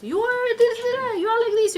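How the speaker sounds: mock ranting husband. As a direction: rail at her, lightly acted.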